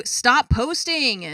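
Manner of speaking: slow, drawn-out plea to stop; exasperated plea. Real, not acted. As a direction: beg them to stop, worn out. Exasperated plea at Reddit posters.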